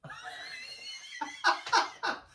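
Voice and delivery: big shrieking laugh; loud laughing shout. Real, not acted. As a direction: burst out laughing loudly. Laughing at costume idea.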